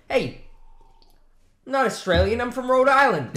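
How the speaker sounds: put-on high Rhode Island accent; hammed-up Rhode Island American. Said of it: "Can you do an American accent?".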